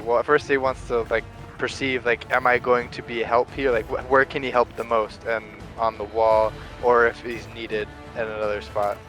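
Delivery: ordinary delivery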